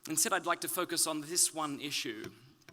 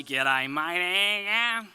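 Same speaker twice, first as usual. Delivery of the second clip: broad Australian G'day, louder; Australian accent; 3 semitones higher, 8 dB louder than usual. Retold or imitated. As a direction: drawl it like a true Aussie bloke. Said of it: "sound a little more British".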